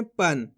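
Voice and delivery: पन ending in a half न, pitch dropping; half-n nasal. Shown as a demonstration. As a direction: say पन as one syllable closed on n. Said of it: "आधा ना के रूप में इसका उच्चारण निकालेंगे".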